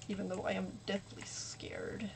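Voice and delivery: hushed, trailing whisper; very soft, almost voiceless; scared whisper. Real, not acted. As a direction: let it drop away to a nervous whisper. Scared aside during horror game.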